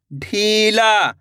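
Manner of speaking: ढीला very slowly, ee held long; ढीला, held long for learners; drawn-out long vowel. Shown as a demonstration. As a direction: sound out ढीला in stretched syllables. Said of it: "तो आपको खींच के पढ़ना है".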